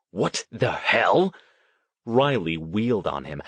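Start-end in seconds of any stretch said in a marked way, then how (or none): 0.1-1.3 slow, incredulous question; affronted disbelief. A narrator's reading. Riley stunned by Stone's rebuke, affronted disbelief. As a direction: draw it out in outrage.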